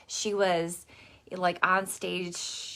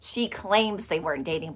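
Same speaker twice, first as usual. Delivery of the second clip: normal talking voice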